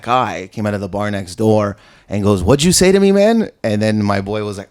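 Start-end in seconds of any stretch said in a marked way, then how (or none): 2.4-3.5 louder, higher mock challenge; confrontational challenge. Stranger from the bar challenging a man, confrontational. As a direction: square up to someone, retold.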